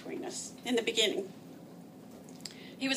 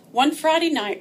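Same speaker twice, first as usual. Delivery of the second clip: usual speaking voice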